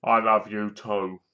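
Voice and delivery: flat, slow, low line reading like a terrible actor; wooden bad actor. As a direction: deliver it lifeless and slow, mocking the bad actor. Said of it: "This was him".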